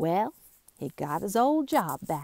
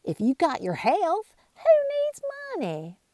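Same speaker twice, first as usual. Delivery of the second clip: high cheerful voice; 10 semitones higher than usual. Acted. Cheerful townsfolk retort.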